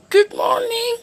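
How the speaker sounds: very high, small and sweet; tiny girl's voice. A narrator's reading. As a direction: squeak it like a tiny person. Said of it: "said little Miss Tiny".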